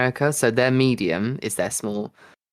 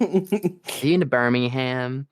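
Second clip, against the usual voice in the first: slow, drawn-out American question; mock American accent; much slower than usual. Imitating Americans asking about Birmingham, exaggerated American accent. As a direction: stretch the last word, mock American. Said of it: "how Americans say Birmingham".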